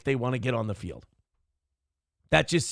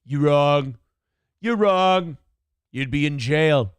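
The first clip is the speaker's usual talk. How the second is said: louder, blunt Trump jab; Trump impression; 5 dB louder, slower than usual. Trump interrupting on a live mic, louder blunt jab. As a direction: hit it like Trump cutting in. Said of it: "he can come in there and just".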